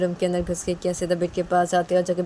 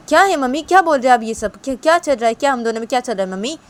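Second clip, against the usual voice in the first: daughter protesting to mom, louder; बेटी की झुंझलाहट; 5 semitones higher, 8 dB louder than usual. Acted. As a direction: push back at mom in a raised voice. (माँ से झुंझलाकर सवाल करती बेटी)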